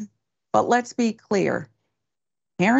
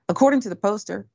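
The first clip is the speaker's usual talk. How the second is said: normal talking voice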